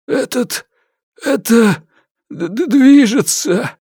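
high, stammering, shaky with terror; trembling whisper. A narrator's reading. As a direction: stutter it out, frozen in fear. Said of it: "прошептал Мэтью с пола дрожащим голосом".

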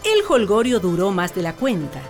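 ordinary talk

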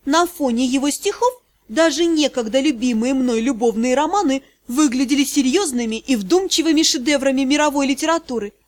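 usual speaking voice

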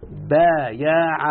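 "بايع" stretched very slowly, every sound held; original form drawn out. Shown as a demonstration. Teacher showing the student the root form, very slowly drawn out. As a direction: draw out "بايع" so the hidden ya is heard. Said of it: "باع يا مولانا أصل الكلمة".

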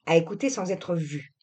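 usual speaking voice

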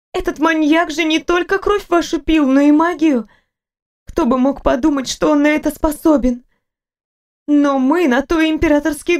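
a fussing nurse who cannot stop talking; chatty nurse. Acted.

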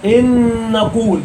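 "إن نقول" with the two n's fused and held; noun merged into noun. Shown as a demonstration. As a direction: draw out one nasal n across "إن نقول". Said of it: "لأن نون في نون".

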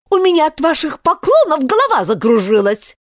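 high-pitched, irritated complaint; yelling queen. A narrator's reading. Queen fed up with the bowing, cross high yelp. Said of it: "завопила королева".

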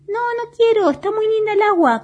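negativa agudísima de juguete; niégate con vocecita finita y caprichosa; bolita caprichosa. A narrator's reading. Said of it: "contesta la bolita". (berrinche de bolita de cuento)

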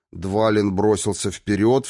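ordinary delivery

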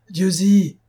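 alarmed announcement. A narrator's reading. Staff bringing the chief bad news, alarmed cry. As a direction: blurt the name out in alarm. Man reports attack on inspector.

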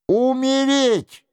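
high, imperious threat; sharp, commanding. A narrator's reading. Duchess threatens the king into signing, sharp and commanding. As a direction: cut in sharply, pitched high, giving orders. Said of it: "возразила резким повелительным голосом герцогиня".

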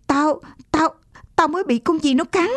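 high, frightened stammer; pained, panicky boy's voice; startled cry. A narrator's reading. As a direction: stammer out the shock of being hurt. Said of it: "thằng Bi chợt la lên".

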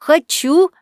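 happy, high-pitched yes; delighted. A narrator's reading. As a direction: burst out with a child's delight. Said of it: "обрадовался пацанёнок".

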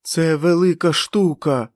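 high, loud, slow gleeful boast; a madman's proud delight; joyful. A narrator's reading. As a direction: show off the prize with childlike glee. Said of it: "радісно відказав сміттєбак".

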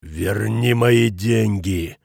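very slow, stretched-out threat; hissed demand. A narrator's reading. Schmidt demanding his money from Futaki, hissing. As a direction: hiss it word by word, drawn out. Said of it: "прошипел Шмидт".

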